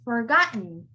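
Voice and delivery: "forgotten" as forgot, catch, "n"; silent T. Shown as a demonstration. Teacher has students repeat, silent T in forgotten. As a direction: swallow the T in "forgotten". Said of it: "That T becomes silent".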